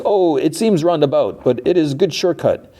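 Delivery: high-pitched, innocent-sounding excuse; acted-out breezy excuse; feigned innocence. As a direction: bluff lightly, pitch well up. Driver bluffing with a flimsy excuse.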